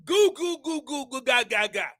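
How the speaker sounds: lightly mimicked infant babbling; loose, run-together mock baby talk; imitated baby babble. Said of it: "every word just seems to kinda run together".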